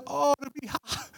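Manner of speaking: soft, high, slow exclamation; moved response. Retold or imitated. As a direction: melt into it, imitating a touched pastor. Pastor moved by the girl's song.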